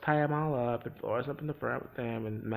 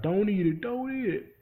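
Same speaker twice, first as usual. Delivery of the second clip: higher, pressed warning; urgent warning; 7 semitones higher, 7 dB louder than usual. Retold or imitated. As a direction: hiss a desperate warning. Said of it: "and Elias like".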